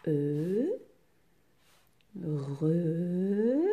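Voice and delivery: E and re each held long, far apart; isolated syllables. Shown as a demonstration. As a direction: sound out E, then re, slowly. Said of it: "Le E de feu".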